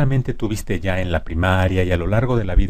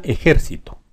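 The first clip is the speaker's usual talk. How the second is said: ejército pitched up, stressed e-JÉR-cito; antepenultimate stress; 5 semitones higher than usual. Shown as a demonstration. Teacher giving the esdrújula example, stressed JÉR in ejército. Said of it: "Esdrújula".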